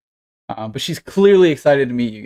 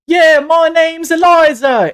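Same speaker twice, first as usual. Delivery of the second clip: put-on high, loud, drawn-out kid shout; excited little girl; 8 dB louder, slower than usual. Small girl pops out from behind her dad, excited shout. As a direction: burst out with a rehearsed big reveal. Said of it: "a small human girl pops out".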